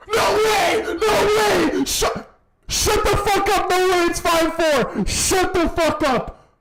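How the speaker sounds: hyped screaming at the top of lungs; esports caster. Real, not acted. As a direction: yell in total disbelief. Late goal, screaming at screen.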